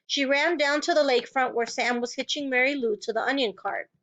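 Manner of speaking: usual speaking voice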